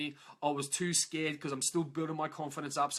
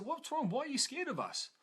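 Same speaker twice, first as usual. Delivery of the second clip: much higher-pitched retold questions; concerned questioning; 9 semitones higher than usual. Asking Regan why she ran away, high concerned questioning. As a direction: lift the voice high, imitating puzzled concern. Asking the woman who fled.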